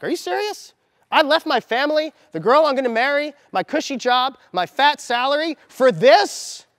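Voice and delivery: high, loud incredulous rant; outraged disbelief. Retold or imitated. Retelling his shock at the fake job, high and indignant. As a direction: let the betrayal burst out, rising.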